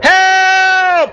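very high drawn-out wail; panicked apprentice, voice pitched sky-high; desperate cry for help. A narrator's reading. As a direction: cry out as if going under. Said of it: "Humboldt cried".

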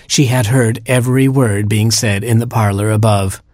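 plain reading voice

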